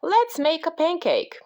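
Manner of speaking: high storybook call; mild shout in a high voice. A narrator's reading. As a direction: pitch it up as Jack calling out. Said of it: "shouted Jack".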